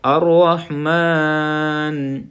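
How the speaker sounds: slow, drawn-out tajweed recitation; Quranic recitation. Shown as a demonstration. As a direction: chant each word slowly. (ٹھہر ٹھہر کر تلاوت)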